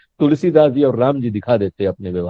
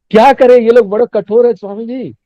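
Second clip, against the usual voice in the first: mock exasperated plea, pitched higher; exasperated complaint; 6 semitones higher, 4 dB louder than usual. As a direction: act out a devotee justifying himself to the guru. Devotee's complaint acted out for swami.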